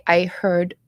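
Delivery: normal talking voice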